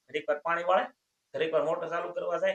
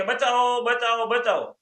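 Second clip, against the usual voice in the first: raised, higher calls for help; mock cry for help; 5 semitones higher, 5 dB louder, slower than usual. Bystander calling out as a man drowns, mock shout. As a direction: shout for rescue, hammed up.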